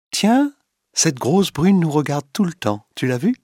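a lightly voiced jealous lady, suddenly alert; sudden remark. A narrator's reading. Clotilde at the theatre, nudging her lover about the stranger, sharp and sudden. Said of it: "dit soudain Madame de Marelle".

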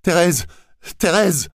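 high, piercing cries for help; desperate cry. A narrator's reading. Camille drowning, crying out to Thérèse in terror. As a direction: scream her name in panic. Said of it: "a-t-il crié".

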